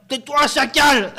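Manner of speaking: slow, high sneering insult; mock thug insult. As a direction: spit it like a drunk thug. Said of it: "En ces termes". (insulte de voyou imitée)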